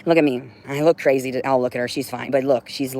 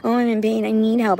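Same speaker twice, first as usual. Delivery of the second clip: whiny mock complaint; 7 semitones higher than usual. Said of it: "He was like".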